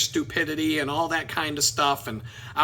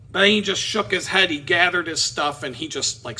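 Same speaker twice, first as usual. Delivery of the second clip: ordinary talk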